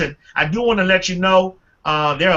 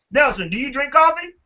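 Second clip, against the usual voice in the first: usual speaking voice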